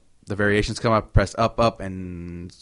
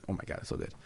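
whispered; 5 semitones lower, 15 dB quieter, faster than usual. Real, not acted. Gushing about favourite game.

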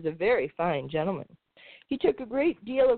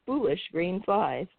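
ordinary delivery, nothing marked

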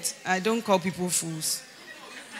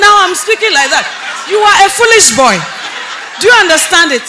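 high, full-voiced retort and telling-off; loud scolding; 8 semitones higher, 14 dB louder than usual. Mentor answering the young man's protest, loud high scolding. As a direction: hammer it home at a shout, pitched up. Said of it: "I've never heard you speak like that".

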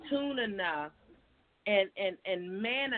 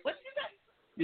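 laughing mock question; 5 dB quieter, much slower than usual. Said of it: "mocking confusion".

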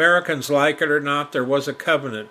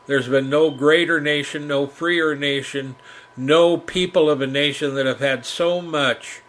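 ordinary talk, nothing marked

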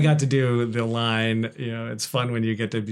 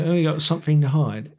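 higher, louder Cockney with th as f; Cockney accent; 5 semitones higher, 4 dB louder than usual. Shown as a demonstration. Actor showing radio host the th sound, Cockney accent. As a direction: say SOMETHING Cockney-style, with an f. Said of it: "it comes out like an F".

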